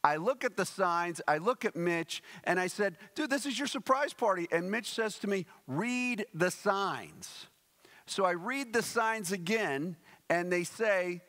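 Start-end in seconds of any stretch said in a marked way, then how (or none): 3.1-4.5 high, quick retold outburst; excited exclamation. Preacher telling Mitch about the party, acted excitement. 5.7-7.5 very slow, higher-pitched mock patience; pointed instruction. Mitch correcting the clueless preacher, slow pointed emphasis.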